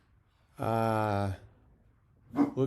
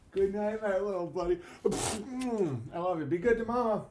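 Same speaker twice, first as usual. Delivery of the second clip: high soft goodnight; 7 semitones higher, 3 dB quieter than usual. Real, not acted. Father saying goodnight to his little son, tender and high. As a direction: coo it gently to a little one.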